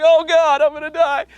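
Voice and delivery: very high, loud retold panicked yell; acted panic. Speaker awaits his turn with powder, acted panicked yell. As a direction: shriek it in hammed-up terror.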